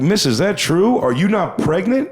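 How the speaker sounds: high, loud astonished question; mock amazed. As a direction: act stunned at the news. Comic asks heckler, amazed.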